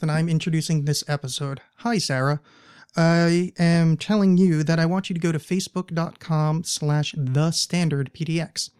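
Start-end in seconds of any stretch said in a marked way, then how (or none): none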